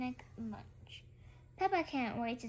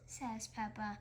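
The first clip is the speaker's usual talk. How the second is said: plain reading voice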